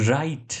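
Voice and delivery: "right" starting straight on R, no W; silent W. Shown as a demonstration. Teacher showing learners the word "right", silent-W pronunciation. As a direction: say "right" leaving the written W silent. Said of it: "ડબલ્યુ નો ઉચ્ચાર નથી કરવાનો".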